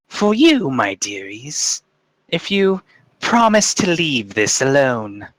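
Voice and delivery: high then slow, drawn-out dearies; Rumpelstiltskin-style crone. Acted. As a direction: croon it like a creepy trickster. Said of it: "It sounded like Mister Gold to me".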